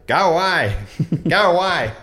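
high, loud go away calls; loud bird call. Shown as a demonstration. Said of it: "calling loudly".

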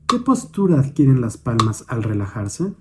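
calm, measured meditation guidance with long pauses; guided meditation. Real, not acted. Instructor guiding a listener's attention to the hands, meditative. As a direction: guide gently, leaving space after each prompt.

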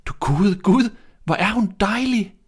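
high, loud exclamation of wonder; delighted cry. A narrator's reading. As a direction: gasp it out in delight, pitched high. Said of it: "råbte de".

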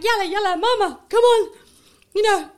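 high, loud whiny plea; imitating her daughter. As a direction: nag mum to come home, mock-pleading.

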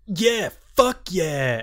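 mock cheer. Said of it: "the other dude was like".

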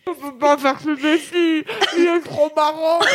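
speaking through marshmallows; mouth full. Real, not acted. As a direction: talk around a stuffed mouth. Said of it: "mouth full of marshmallows".

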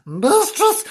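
high-pitched storybook cry for mistress; alarmed call. Acted. Frank runs off calling mistress.

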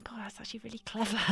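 whisper. Real, not acted. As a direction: whisper in quiet wonder. Amazed thought whispered aloud.

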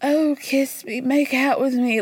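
high, mock-amorous woman's voice; amorous Lisa. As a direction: swoon it high, hammed-up. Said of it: "our Lisa jump scare when you find out that she's horny".

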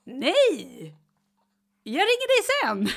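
high, loud mock panic; hammed-up flustered husband; husband hurrying off the phone in panic; panicked husband.

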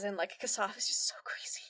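soft whispered aside; a gossipy whisper to the listener. Real, not acted. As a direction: whisper it like a secret. Aside about Kassav.

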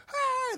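high jeering crowd cry; mocking crowd shout. Thirty strangers jeering at a man waking up, high mocking cry. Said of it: "Just going like".

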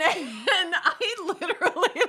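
normal talking voice

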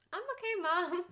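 high, squeaky put-on American kid; exaggerated high-pitched American voice, imitating; American accent. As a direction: chirp it in a mock American accent. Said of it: "I don't know what American accent is about".

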